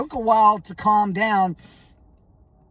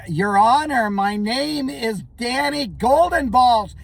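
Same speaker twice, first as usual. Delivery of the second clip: deliberate, slow insistence on Golden Balls; slow insistent correction; 3 semitones higher, 3 dB louder, slower than usual. Retold or imitated. Lawyer repeating his name to the furious judge, slowly. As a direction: drag out the name, dead serious. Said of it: "I'm like".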